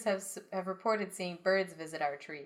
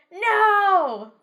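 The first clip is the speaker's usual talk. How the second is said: crowd shout; 13 semitones higher, 15 dB louder than usual. Acted. Crowd answers the elder.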